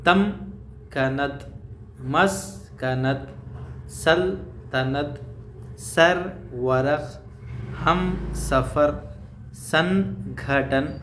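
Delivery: "सर वरख", "हम सफ़र" split into slow, separate beats; syllable-by-syllable reading. Shown as a demonstration. Teacher reading out five-letter example words, slowly syllable by syllable. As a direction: read "तम कनत", "मस कनत" in two measured parts. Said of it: "पाँच हरफी लफ्ज़ हैं तीन हरकत के साथ".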